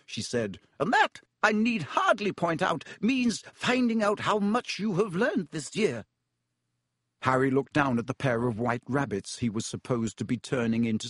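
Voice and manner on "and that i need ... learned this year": crisp disapproving governess; high, clipped stern teacher. A narrator's reading. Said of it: "scowl even more darkly".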